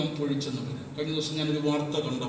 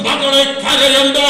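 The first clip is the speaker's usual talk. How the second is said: slow, louder call of മകളെ കരയണ്ട; prophetic declaration; 10 semitones higher, 14 dB louder, slower than usual. Real, not acted. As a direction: declare it to the grieving listener. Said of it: "ചിലരോട് പരിശുദ്ധാത്മാവിൽ ഞാൻ പറയുകയാണ്". (പ്രവചനാത്മകമായി ഉറക്കെ പതുക്കെ പ്രഖ്യാപിക്കുന്നു)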